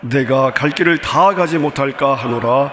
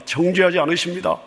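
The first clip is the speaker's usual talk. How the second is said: ordinary talk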